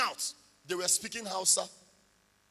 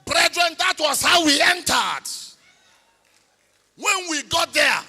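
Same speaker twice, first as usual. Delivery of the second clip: louder and higher, rallying the room; preaching at full force; 6 semitones higher, 11 dB louder than usual. Real, not acted. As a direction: lift the congregation with you. Preaching climax to congregation.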